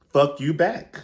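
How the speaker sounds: high, loud fuck you back with back rising; sassy mock retort. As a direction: fling it back at her. Clapping back at an insult.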